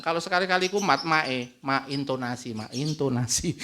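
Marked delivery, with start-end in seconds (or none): 1.6-3.5 softly voiced child, a little lower; a light, hushed reminder in a child's role; child reminding mother. Child reminds mother about her intonation when she lapses, softly chiding. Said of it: "itu anaknya bilang".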